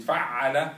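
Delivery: Fa'ala called out loud with a rising end; model for repeating. Shown as a demonstration. Teacher leading a call-and-response drill, loud. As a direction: chant Fa'ala to the class.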